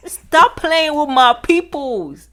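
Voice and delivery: slow, loud and deliberate, each word weighted; slow emphatic warning. Acted. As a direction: lay down the law like God warning off enemies. Voicing God, slow emphatic.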